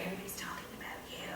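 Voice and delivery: fast, unvoiced whisper; sneaky quick whisper; whispered inner critic. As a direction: whisper it like the nagging voice in your head.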